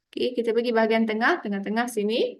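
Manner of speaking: ordinary talk, nothing marked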